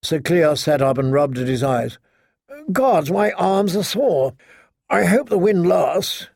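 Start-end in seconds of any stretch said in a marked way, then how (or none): 2.7-6.3 higher-pitched voice of a waking knight; complaining sleepy knight. A narrator's reading. Just-woken knight to his boat companions, high complaint. Sore, waking traveller in the story.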